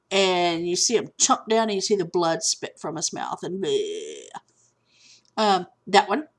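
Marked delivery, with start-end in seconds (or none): 3.6-4.3 low, drawn-out bleh; imitated bleh. Retelling the shark chomping Quint, imitated bleh. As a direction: mimic a gory spit with a long low "bleh". Imitates blood spat from mouth.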